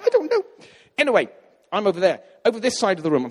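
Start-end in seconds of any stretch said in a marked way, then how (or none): 0.0-0.4 much higher and louder, bewildered squeak; helpless squeak. Retold or imitated. Answering his own panic, high helpless squeak.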